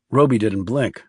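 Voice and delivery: ordinary delivery, nothing marked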